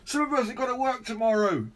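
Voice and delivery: high, fast cross neighbour; another neighbour yelling. Acted. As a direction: snap it from a window nearby. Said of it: "yelled another".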